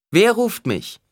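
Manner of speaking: high, loud demand; calling out. A narrator's reading. Alia asks who in the crowd calls her, loudly. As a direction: call out across a great hall.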